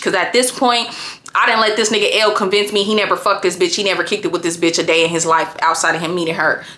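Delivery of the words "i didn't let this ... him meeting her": normal talking voice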